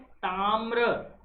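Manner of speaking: ताम्र stretched very slowly, every sound held; ताम्र spoken slowly, syllable by syllable; drawn-out demo. Shown as a demonstration. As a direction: say ताम्र slowly and clearly for students. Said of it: "ताम्र कैसे लिखते हैं".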